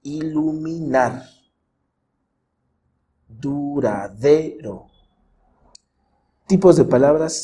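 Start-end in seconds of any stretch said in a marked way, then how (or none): 0.0-1.3 iluminar slowly, syllable by syllable, weight on final NAR; stressed syllable shown. Shown as a demonstration. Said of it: "una sílaba que suena más fuerte". 3.3-4.9 duradero very slowly, weight on DE; stressed syllable shown. Shown as a demonstration. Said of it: "una sílaba que suena más fuerte".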